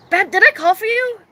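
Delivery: high, puzzled questioning; stoned loop question. Retold or imitated. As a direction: imitate your dazed self calling out. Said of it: "this one loop that I will never forget".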